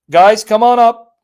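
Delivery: loud call to the room. Real, not acted. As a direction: call them up, loud and slow. Host calling guests onto the stream.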